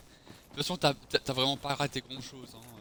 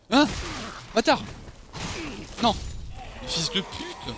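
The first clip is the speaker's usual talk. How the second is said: sudden furious shout, higher and louder; raging gamer; 7 semitones higher, 4 dB louder than usual. Real, not acted. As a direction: yell the insult at the screen. Streamer dies, insults the enemy.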